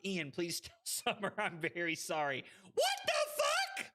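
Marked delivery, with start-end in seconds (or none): 2.7-3.9 very high screech of shock; alarmed disbelief. Real, not acted. Alarmed reaction in the game.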